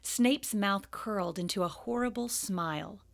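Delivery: plain reading voice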